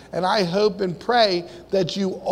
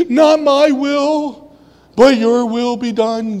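slow, loud, high surrender prayer; drawn-out prayer of surrender; preached prayer; 4 semitones higher, 6 dB louder than usual. Acted.

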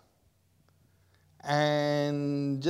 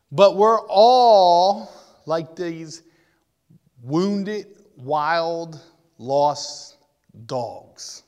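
louder, slow, drawn out; preaching emphasis; 9 dB louder, much slower than usual. Real, not acted. Preacher telling the crowd they're all wild dogs, slow and loud. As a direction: lean hard on each word. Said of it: "preaching emphasis".

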